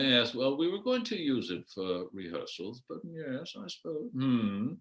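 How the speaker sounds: lightly voiced hesitant Yorkshireman; mild Yorkshire-free imitation, hedging; Yorkshireman Morris. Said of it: "I can't do a Yorkshire accent".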